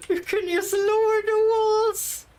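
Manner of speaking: high, drawn-out wail; dismayed. Real, not acted. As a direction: stretch it out in pained disbelief. Dismayed at finding an easy option.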